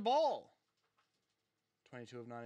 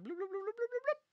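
rising, squeaky mock bloops climbing ever higher; bloop noises; 3 dB quieter, faster than usual. Teasing the Timberwolves' hits with mock bloops. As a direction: pop out silly bloop sounds, each one higher. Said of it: "They've just been like".

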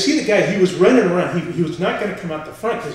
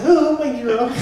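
high, loud mock swoon; adoring girlfriend; 10 semitones higher, 6 dB louder than usual. Girlfriend admires her witness boyfriend, hammed-up swoon. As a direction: imitate a swooning admirer in falsetto. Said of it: "My girlfriend".

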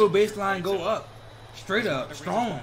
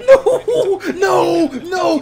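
laughing no; 7 semitones higher, 10 dB louder, much slower than usual. Real, not acted. Reacting to a shot.

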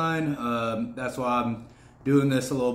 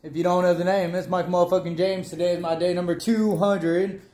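hyped up; 5 semitones higher, 5 dB louder than usual. Real, not acted. Hyped vlog intro shout.

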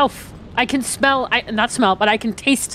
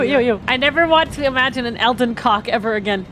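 ordinary talk, nothing marked

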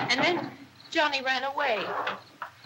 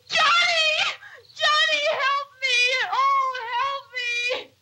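crying out for her brother, hysterical; screaming in terror; 9 semitones higher than usual. Real, not acted. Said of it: "Oh, I screamed".

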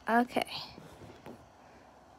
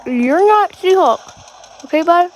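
loud, higher trash-talk yell; shouting at opponent; 4 semitones higher, 6 dB louder than usual. Real, not acted. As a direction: yell it at the enemy across the map. Yelling at opponent mid-fight.

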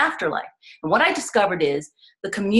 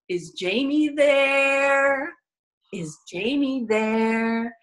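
put-on slow, higher French accent, drawing out there; a distant French man calling slowly, stretching there; French-accented ghost voice; 5 semitones higher, much slower than usual. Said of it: "the voice had an accent and prolonged the word there".

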